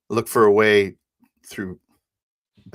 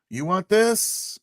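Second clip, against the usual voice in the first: high, loud, stretched-out question; taunting call; 12 semitones higher, 5 dB louder, much slower than usual. Acted. Pilot holding package out to enemy, taunting call. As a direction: dangle it slowly as a taunt.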